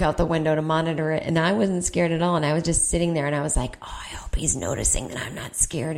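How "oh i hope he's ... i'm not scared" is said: softer secret whisper; whispered thought. Retold or imitated. To herself beside the panicking seatmate, smug whisper.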